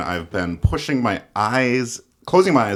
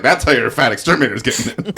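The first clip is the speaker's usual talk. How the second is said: Cameron voice; 5 semitones higher, 6 dB louder, faster than usual. Retold or imitated. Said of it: "Cameron's like".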